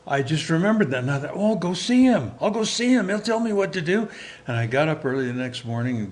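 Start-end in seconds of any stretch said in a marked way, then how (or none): 1.3-4.1 high, eager rush of hope; light up at the idea of seeing him; a lovesick boy's sudden hopeful plan. Retold or imitated.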